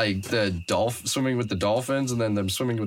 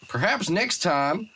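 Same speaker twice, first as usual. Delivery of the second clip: a slow, higher, botched Australian magistrate; a slow stab at an Australian judge that misses; failed Australian accent; 7 semitones higher, slower than usual. Retold or imitated. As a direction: aim for Australian, slide somewhere else. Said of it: "that's not Australian at all".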